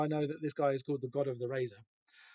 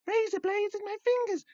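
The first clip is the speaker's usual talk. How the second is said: mock squeaky voice. Mimicking villain with blades.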